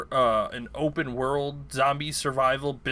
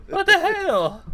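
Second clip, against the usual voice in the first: high, laughing disbelief; surprised laugh; 6 dB louder, slower than usual. Real, not acted. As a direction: burst out laughing in shock. Surprise at multiplayer hat.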